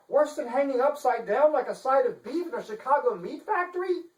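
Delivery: high, prim and indignant; a dainty lady playing at outrage; fussy chancellor. Chancellor protesting to the detective, high and prim.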